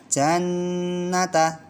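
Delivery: jannata, nn ditahan pelan dan tinggi; held gunnah. Shown as a demonstration. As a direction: tahan nn di jannata dua harokat. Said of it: "dua harokat ya". (jannata dengan dengung panjang)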